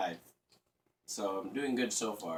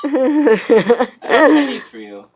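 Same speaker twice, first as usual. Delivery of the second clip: loud, high, drawn-out mock Chinese accent; slow, shouted parody of a Chinese accent; 12 semitones higher, 18 dB louder, much slower than usual. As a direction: exaggerate a bad imitation of a Chinese accent. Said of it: "Sounds like this, kind of".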